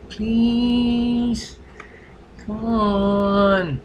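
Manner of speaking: high, whiny put-on begging voice; mock pleading. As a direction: beg like a kid who wants the computer. Begging friend for the computer.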